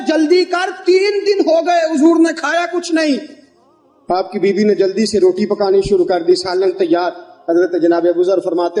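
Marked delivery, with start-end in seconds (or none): 0.1-3.2 very high, impassioned retold cry; urgent outcry. Companion telling his wife to hurry for the starving Prophet, urgent outcry. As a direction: cry it out urgently, pitched far up.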